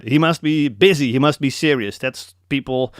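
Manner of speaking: ordinary talk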